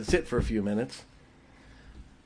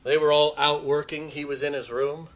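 ordinary delivery

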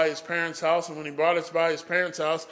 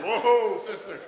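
raised, amused "slow down" plea; higher, half-laughing call to hold up; mock alarm; 5 semitones higher, slower than usual. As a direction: act out halting someone charging at you. Calming woman running toward him.

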